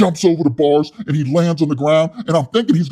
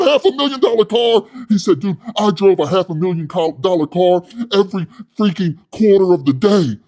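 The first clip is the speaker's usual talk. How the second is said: high, loud, puffed-up yelling; loud hothead Wes; 3 semitones higher, 4 dB louder than usual. Retold or imitated. Wes scolding his soy boy cellmate, loud and high. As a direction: rant like a tough guy with something to prove. Wes bragging about cars.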